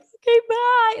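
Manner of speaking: very high, loud overwhelmed squeal; squeaked sign-off. Retold or imitated. Ending the call with her parents, high squeaked goodbye. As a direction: re-enact a squeaky, overexcited goodbye.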